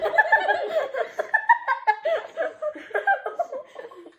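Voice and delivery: helpless high giggle; high laughing fit. Real, not acted. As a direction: burst into squealing laughter. Friend eliminated by sour face.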